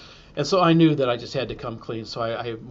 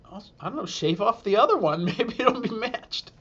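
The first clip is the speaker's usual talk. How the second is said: laughing suggestion; 7 semitones higher than usual. Retold or imitated. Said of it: "And she said".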